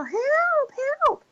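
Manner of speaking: put-on girly cry for help. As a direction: imitate calling for help like a girl. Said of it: "when you use a high-pitched voice".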